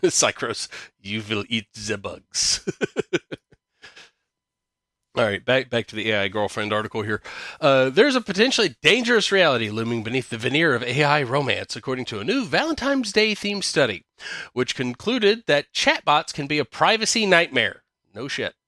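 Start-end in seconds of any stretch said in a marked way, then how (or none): 1.0-2.7 slow, mock German accent; bad German accent. Host jokes to co-host after slogan talk, bad German accent. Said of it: "a bad German accent". 7.9-17.8 higher-pitched, animated news read; article read-aloud. Acted. Host reads AI girlfriend article to co-host, article read-aloud.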